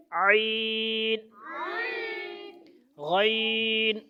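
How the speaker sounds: عین and غین each drawn out very slowly; slow demo of عین and غین; slow letter model. Shown as a demonstration. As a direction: hold each letter long for the child. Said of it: "ناک بند کرو ایک ہاتھ سے".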